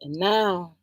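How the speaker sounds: ordinary talk, nothing marked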